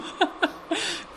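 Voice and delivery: short giggle, pitched high; high amused laugh. Real, not acted. Laughs at shabby linoleum. (kurzes Kichern)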